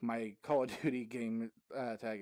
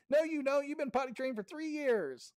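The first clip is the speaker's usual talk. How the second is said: high, loud mock mom voice; imitating scolding mom; 14 semitones higher, 5 dB louder than usual. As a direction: play the mom who barges in to set him straight. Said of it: "his mom come in the room and goes".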